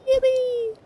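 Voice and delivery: high squeaky cheer, sing-song; squeaky creature voice. Acted. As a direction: chirp it like a tiny cartoon critter. Game creature cheering its health.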